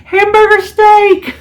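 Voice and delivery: high squealed laugh through the words, slow and loud; incredulous laughing exclamation; laughing reveal. Real, not acted. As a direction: laugh it out at her own mistake. Reveals she planned the same meal.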